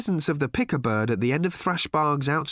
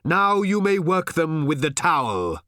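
exclaimed; 5 semitones higher, 3 dB louder than usual. A narrator's reading. Old Thrashbarg sends Arthur at the herd, exclaiming. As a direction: call it out as a grand signal. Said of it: "exclaimed old Thrashbarg at last".

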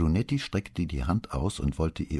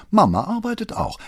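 higher and louder comeback; sharp retort; 8 dB louder than usual. Acted. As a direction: fire it straight back at him. Daughter retorts to father.

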